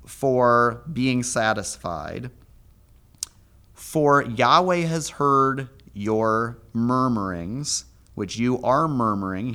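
measured scripture reading of the gloss; slow translation reading. Acted. Teacher reading his translation of the verse aloud, slow and deliberate.

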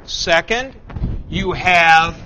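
usual speaking voice

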